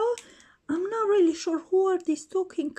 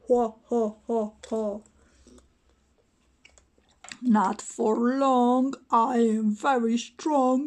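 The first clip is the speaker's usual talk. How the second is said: much lower, gruff tough-guy boasting; toy Batman voice; 7 semitones lower than usual. Pretend. Batman taunting the other toys, deep boastful voice. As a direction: play the bully hero claiming his spot.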